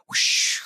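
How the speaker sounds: breathy unvoiced whoosh; whoosh sound effect. Mimicking the other show's sound effects, whispered whoosh. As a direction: imitate the wind effect in a whisper. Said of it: "sound effect things".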